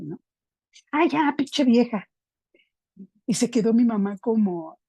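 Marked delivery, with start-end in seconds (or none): 0.9-2.1 high, drawn-out exasperated blurt; arrebato grosero. Retold or imitated. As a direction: re-enact a kid's insolent outburst at mom, pitched up and slow. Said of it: "y que se me sale". (exasperación insolente, aguda y alargada)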